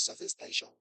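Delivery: barely audible mumbled lecture; very soft, under-the-breath teacher impression; inaudible teacher. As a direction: imitate a teacher nobody can hear. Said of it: "Sir, we can't hear you".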